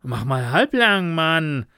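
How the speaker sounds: alarmed and urging; worried call. A narrator's reading. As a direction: call out in concern to slow a friend down. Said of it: "rief Jake besorgt aus".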